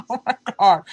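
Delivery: a loud, laughing "oh my God"; laughing disbelief. Real, not acted. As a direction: burst out laughing while exclaiming. Friend's joke cracks her up.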